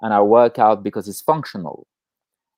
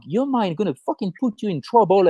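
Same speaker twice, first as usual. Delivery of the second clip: usual speaking voice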